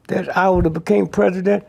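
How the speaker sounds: normal talking voice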